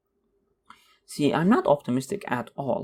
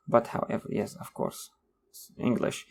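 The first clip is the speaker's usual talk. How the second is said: breathy unvoiced mutter to himself; whispered aside; 3 semitones lower, 4 dB quieter than usual. Comic mutters to himself about his English, whispered.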